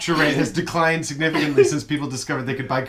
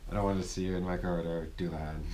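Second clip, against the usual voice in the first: low mock threat; 9 semitones lower, 13 dB quieter than usual. Mock warning to Dullahan.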